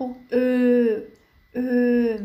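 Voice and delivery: "أو" as a long, rounded German ö; o umlaut. Shown as a demonstration. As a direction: hold "أو" as ö, not o. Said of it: "تسمى أو أوملاوت وتنطق". ("أو" تنطق ö مع الإطالة)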